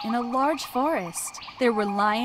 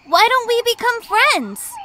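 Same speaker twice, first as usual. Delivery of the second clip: eager suggestion; 11 semitones higher, 10 dB louder than usual. A narrator's reading.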